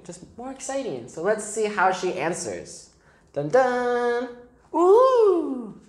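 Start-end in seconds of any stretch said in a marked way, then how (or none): 3.3-4.3 high, held dun-dun; dramatic sting. Acted. Teacher building up the child's answer, high dramatic sting. 4.7-5.8 high, loud, drawn-out ooh; wondering ooh. Acted. Teacher showing a new page, high amazed ooh.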